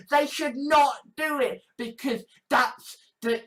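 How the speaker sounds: usual speaking voice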